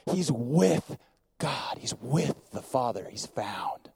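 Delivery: fading down to a whisper; hushed. Acted. Preacher to the congregation, fading to a hushed whisper. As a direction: let it sink to a breath, slow. Sermon climax, voice drops away.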